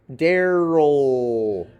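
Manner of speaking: Daryl ending in one long vowel-like L; slow Daryl, no clear schwa before the L; vocalic L. Shown as a demonstration. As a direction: draw Daryl out into a held, falling L. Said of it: "I'm gonna do mine as".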